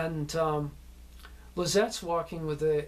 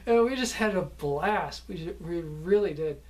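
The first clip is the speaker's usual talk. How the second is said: ordinary talk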